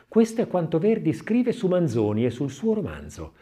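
usual speaking voice

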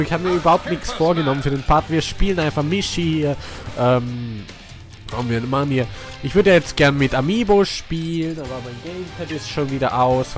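ordinary talk, nothing marked

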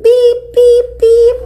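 put-on bright toy horn for the kids; horn beep. Shown as a demonstration. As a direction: honk it like the truck's horn. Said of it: "hear the sound of the truck again".

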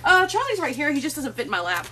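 ordinary talk

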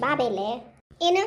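normal talking voice

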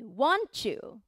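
"want you" run together as "wan-chu"; CH-blend. Shown as a demonstration. Teacher explaining the linking to learners, "want you" as wan-chu. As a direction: say "want you" with a Japanese chuu at the end. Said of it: "日本語のチューみたいに".

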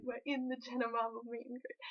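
normal talking voice

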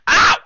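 loud, sudden "ow!"; pained yelp. Real, not acted. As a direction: cry out sharply in pain. Hurt by the cat.

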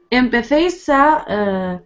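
ordinary talk

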